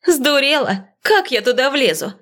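high-pitched indignant outburst; indignant disbelief. Acted. Woman told to climb into a car trunk, indignant. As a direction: balk at an absurd order, pitched high.

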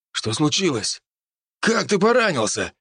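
startled, rising alarm; a worried hunter asking what happened; alarmed question. A narrator's reading. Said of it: "спросил его другой мужчина". (испуганный вопрос)

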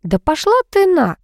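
high-pitched angry comeback; acted irritable outburst; snapped retort. A narrator's reading. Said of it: "огрызнулась колдунья".

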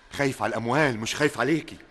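loud, indignant denouncing; heated accusation. Acted. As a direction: hammer the accusation home. Suitor denounces the colonel.

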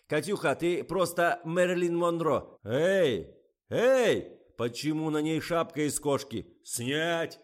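high, loud director's calls; excited shouting. A narrator's reading. Director shouts at model and crew, loud and excited. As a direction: holler praise, then indignant orders.

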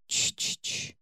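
мягкое шёпотом «ч-ч-ч»; тихо шикни, успокаивая; успокаивающее шиканье. Acted. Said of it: "успокаивающе зашептал незнакомец на ухо". (успокаивающе шепчет «ч-ч-ч»)

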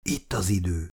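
soft, low call to move; father quietly signals the escape. A narrator's reading. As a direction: hush it so no one hears. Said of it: "szólalt meg Chris halkan".

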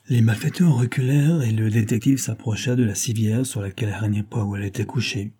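ordinary delivery

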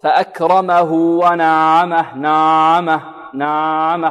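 "نعمه" with a loose, drawn-out ع, sound flowing on; ayn too lax. Shown as a demonstration. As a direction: let the ع in "نعمه" run on slackly. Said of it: "قريبة من الرخوة".

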